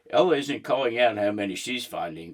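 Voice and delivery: ordinary delivery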